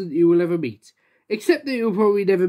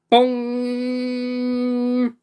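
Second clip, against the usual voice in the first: a held ringing bong; be the clock tower bell; 5 semitones higher than usual. Acted. The clock strikes midnight.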